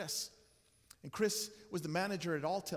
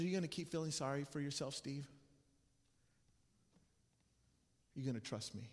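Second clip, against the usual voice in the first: softer, lower, calm and searching; God speaking gently; 4 semitones lower, 5 dB quieter than usual. Retold or imitated. God asks the self-pitying pastor to trust him, soft and gentle. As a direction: ask it quietly, like a loving father. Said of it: "God said to me like this".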